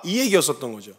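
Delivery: usual speaking voice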